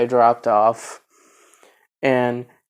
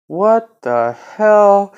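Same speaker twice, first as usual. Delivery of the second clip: high, drawn-out what the hell; slow, stunned, louder exclamation; baffled; 7 semitones higher, 5 dB louder, much slower than usual. Retold or imitated. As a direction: stretch it out in disbelief. Friends baffled by the stranger.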